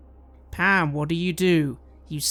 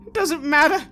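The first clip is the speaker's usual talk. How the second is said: higher, louder, brushing it off fast; a hint of manic impatience; frantic dismissive man; 8 semitones higher, 8 dB louder than usual. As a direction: wave the question away, agitated. GM as Livio, dismissive.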